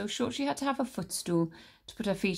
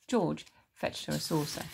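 ordinary delivery

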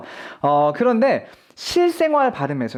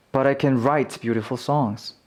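weak CAN leading into WRITE; unstressed can; 6 semitones lower, much slower than usual. Shown as a demonstration. Teacher giving the positive half, unstressed can. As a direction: keep 'can' light, stress 'write'. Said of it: "강세가 없으면 긍정일 때가 많고".

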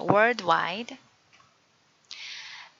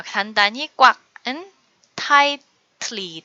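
ordinary delivery

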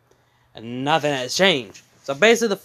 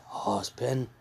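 very soft, simmering acted anger; hushed menace before the blow-up; building anger; 3 semitones lower, 11 dB quieter than usual. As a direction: say it low in volume, rage held in. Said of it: "the anger is bu-, building up".

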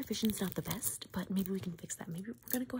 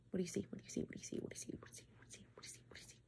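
each repeat softer until barely there; fading repetition; 10 dB quieter, much faster than usual. Acted. ASMR host repeats a question to the viewer, fading out. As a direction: let the question fade into a whisper.

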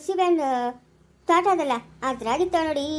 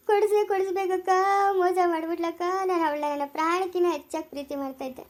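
hammed-up sobbing lament; heartbroken, acted bawling; crying. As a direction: wail it out, stretching the last vowels. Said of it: "ಯಾಕೆ ಅಳ್ತೀಯ?".

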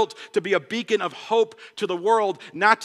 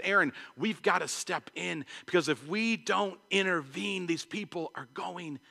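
soft, hushed urgent plea to Aaron; heartbroken plea; 3 semitones lower, 10 dB quieter than usual. Retold or imitated. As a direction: let it drop to a broken near-hush. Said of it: "his heart begins to break".

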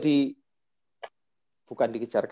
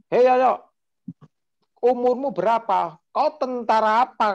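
higher-pitched mock scolding; angry old man; 8 semitones higher than usual. Elderly politician berating the younger man, raised and angry. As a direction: scold him like an offended elder. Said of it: "bapak yang satu itu juga emosi".

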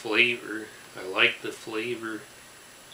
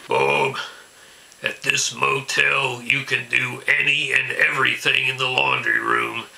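angry ranting caller; 7 dB louder than usual. Acted. Ranting local political caller impression.

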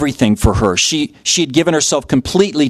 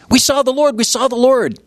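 much higher, breathless exclaiming; excited announcement; 10 semitones higher than usual. Retold or imitated. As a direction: shout the news with joy. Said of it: "rushing back into Jerusalem".

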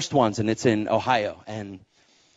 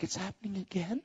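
soft, higher, disbelieving; quietly voiced worried disbelief, rising at the end; incredulous question; 7 semitones higher, 14 dB quieter than usual. Wife hears of another firing, softly incredulous.

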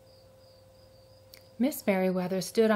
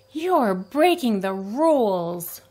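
scolding; 6 dB louder, slower than usual. A narrator's reading. Clerk scolds lion.

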